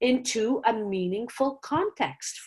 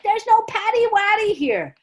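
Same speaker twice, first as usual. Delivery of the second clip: put-on much higher, louder kid protest; laughing kids protesting; 12 semitones higher, 6 dB louder than usual. Students protesting the teacher's silly name in a much higher, louder voice. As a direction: play kids objecting. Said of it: "they're like".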